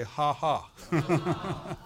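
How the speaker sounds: deliberate ha ha; forced faith laugh. Shown as a demonstration. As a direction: laugh on purpose, not from fun.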